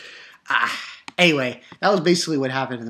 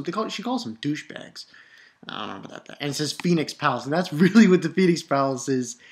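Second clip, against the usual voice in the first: ordinary talk, nothing marked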